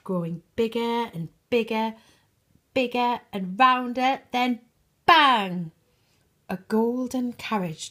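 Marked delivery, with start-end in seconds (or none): 0.0-5.7 rising higher and louder until a loud bang; building to a bang. A narrator's reading. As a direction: grow it bigger and bigger, then burst. Pumpkin magic builds to bang.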